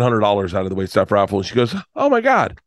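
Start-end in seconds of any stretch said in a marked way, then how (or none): 1.9-2.6 high-pitched retold amazement; high voice of a moved woman; imitated overwhelmed winner. Raffle winner reacts, retold.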